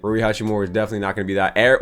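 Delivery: ordinary talk, nothing marked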